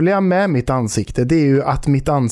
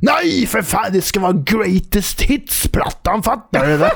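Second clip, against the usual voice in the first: hammed-up grumpy stranger; irritated drunk; 5 semitones higher than usual. The drunk insists on the Greatest Hits album, high and demanding. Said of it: "sen så sa han".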